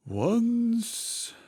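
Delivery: high sighed word of a grieving turtle; deep sigh. Acted. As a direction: sigh it out heavily. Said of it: "with a deep sigh".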